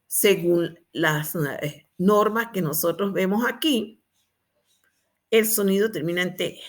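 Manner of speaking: normal talking voice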